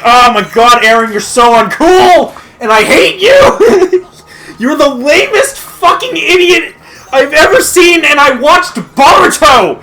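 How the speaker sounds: ranting fan yells at Eren through laughter; laughing mock shout. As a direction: shout it like you're fed up, cracking up. Yelling at Eren.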